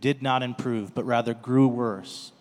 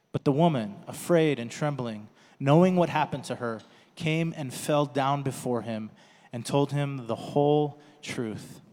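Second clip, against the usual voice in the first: ordinary delivery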